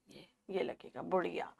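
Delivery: breathy hushed repeat of बुढ़िया; whisper. Shown as a demonstration. Teacher to children, whispering the answer.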